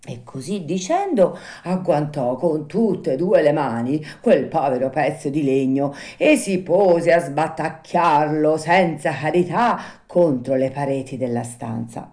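plain reading voice

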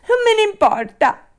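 high, loud bratty retort; Pinocchio, defiant. Acted. Puppet brushes off the fairy's warning, defiant. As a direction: throw it back stubbornly.

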